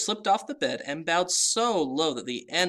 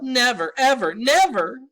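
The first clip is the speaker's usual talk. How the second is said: high loud wailing cry; wailing sob; 12 semitones higher, 10 dB louder than usual. A narrator's reading. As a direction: wail through the tears. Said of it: "he wailed".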